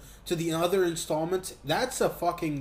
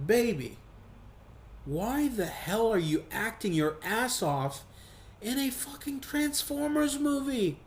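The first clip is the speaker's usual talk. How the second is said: higher, coaxing disbelief, rising to an incredulous peak; exasperated pleading; 5 semitones higher than usual. Pretend. Speaking to the weeping actress, exasperated coaxing. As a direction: plead with her to save it for a better film.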